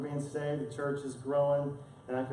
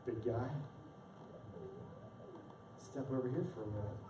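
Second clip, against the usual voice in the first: softer, calm, knowing voice of God; quiet voice of God; 10 dB quieter than usual. Retold or imitated. God calmly calling the proud pastor aside, softly. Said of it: "And God was like".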